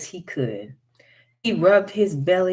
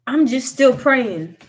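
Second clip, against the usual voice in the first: loud, higher holler; 6 semitones higher, 7 dB louder than usual. A narrator's reading. Wiley hollering at the hairy man below. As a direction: call out over the chopping. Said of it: "Wiley said and hollered again".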